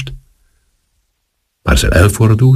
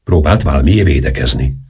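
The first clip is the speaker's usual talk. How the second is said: plain reading voice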